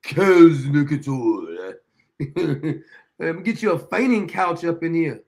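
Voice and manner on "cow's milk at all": low, loud, slow British haughtiness; emphatic mock British. Host mimicking Clever's milk refusal, emphatic mock-British accent. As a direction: draw it out grandly in a put-on British accent. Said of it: "Said it with such emphasis".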